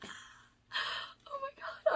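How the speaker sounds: high, stretched-out, tearful oh my god; crying oh my god. Real, not acted. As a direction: let the tears break through the words. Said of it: "I don't wanna cry".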